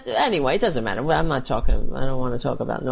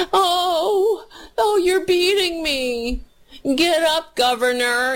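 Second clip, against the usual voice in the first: high, gasping ringside hype, hammed up; mock excited cheering; 12 semitones higher than usual. Mocking the governor in the clip with hammed-up cheers. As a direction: fake gasp and cheer like a fight fan.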